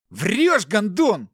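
high, loud accusation; furious roar. A narrator's reading. Captive son accuses his brother of lying, roaring. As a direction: roar it at the liar. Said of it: "заревел Игорь".

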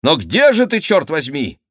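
loud, high, impatient question; calling out. Acted. Favourites call to their unseen friend, loud and impatient. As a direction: shout for someone you can't find, exasperated.

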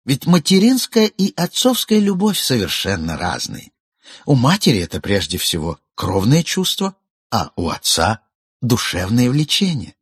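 ordinary delivery, nothing marked

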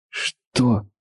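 hoarse, broken whisper of a question. Acted. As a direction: croak it out, voice gone. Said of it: "севшим голосом спросил".

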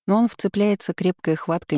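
ordinary talk, nothing marked

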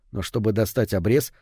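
ordinary delivery, nothing marked

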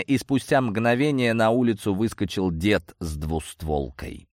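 plain reading voice

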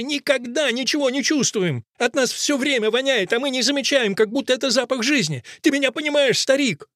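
high-pitched, agitated outcry; Fusia. A narrator's reading. Fusia begs the old man to understand, crying out high. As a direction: plead insistently, voice pushed high. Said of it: "кричит Фуся".

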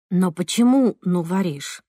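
plain reading voice

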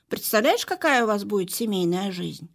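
plain reading voice

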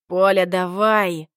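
loud, slow barked command; barked order. A narrator's reading. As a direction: bark the order. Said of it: "рявкнул я".

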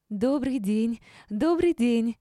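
high, loud, smiling greeting; beaming greeting. A narrator's reading. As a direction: gush hello with a wide smile. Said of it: "расцвела широкой улыбкой Юлия". (радостно, с широкой улыбкой)

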